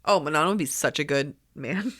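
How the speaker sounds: ordinary delivery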